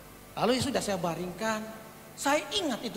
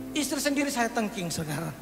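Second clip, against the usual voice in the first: ordinary delivery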